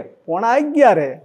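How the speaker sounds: proud answer. Retold or imitated. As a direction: state the hour with serene joy. Calm precise answer.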